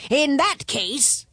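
high, drawn-out royal pondering; slow, high-pitched king voice; bargaining king. A narrator's reading. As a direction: stretch it out as the king.

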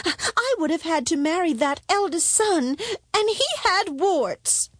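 high princess voice, slowing at the end. Acted. Princess objects to marrying the son, high-voiced. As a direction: complain up high, stretching the last words.

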